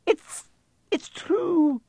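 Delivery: high, tearful falter; falter, close to tears; halting, high-pitched tearful disbelief. A narrator's reading. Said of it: "faltered Professor McGonagall".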